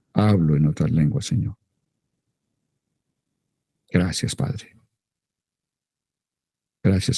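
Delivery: fervent, steady prayer voice; spoken prayer, earnest and intimate. Acted. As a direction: pray aloud over the congregation. Leads a prayer aloud.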